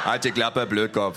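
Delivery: a hint of a rough bully snapping; gruff fat thug. A narrator's reading. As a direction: shut him up. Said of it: "sagt der Dicke". (Dicker Schläger, grob)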